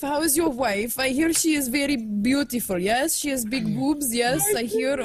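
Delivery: put-on Indian accent call-centre agent; call-centre greeting, Indian accent. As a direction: play a helpline worker in a mock Indian accent. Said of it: "Can you do an Indian accent?".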